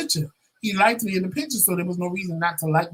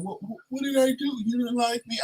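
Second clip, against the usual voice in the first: higher pitched stammering mock confusion; baffled plea; 5 semitones higher, 5 dB quieter than usual. The date asking her what he did, baffled. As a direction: play his bewildered stammer, pitched up. Retelling his baffled reply.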